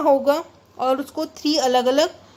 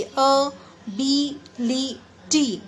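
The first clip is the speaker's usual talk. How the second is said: Ability slowly as a-bi-li-ti; four separate beats on ability, long gaps between; syllable by syllable; much slower than usual. Shown as a demonstration. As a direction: break ability after every syllable. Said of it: "इसमें देखिए".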